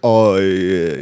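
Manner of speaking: low put-on drawl; Will Ospreay. Podcaster mimics Will Ospreay, low drawn-out voice. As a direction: stretch it into a mock noise. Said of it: "then Will Ospreay's like".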